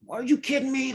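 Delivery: reliving the moment he snapped, voice jumping up; retold angry outburst. Recounting his blow-up at his wife, a high-pitched retold outburst. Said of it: "but I got so angry".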